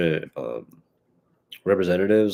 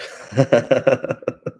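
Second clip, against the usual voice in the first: real high-pitched chuckle; laugh; 6 semitones higher, 4 dB louder than usual. Real, not acted. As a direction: burst of giggling, pitched up. Laughs after announcing first topic.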